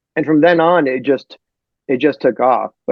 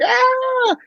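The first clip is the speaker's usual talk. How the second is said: high mock scream of the fly man; a hammed-up shriek, much higher. Retelling The Fly to podcast hosts, high mock scream. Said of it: "and he's like".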